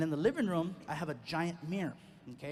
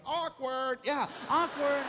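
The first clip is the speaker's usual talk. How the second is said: mock awkward; 8 semitones higher, 4 dB louder, much slower than usual. Bystanders' drawn-out awkward.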